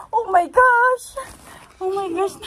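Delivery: high, loud gasping oh my gosh; excited squeal. Retold or imitated. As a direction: squeal it like a thrilled child. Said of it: "Sabi niya".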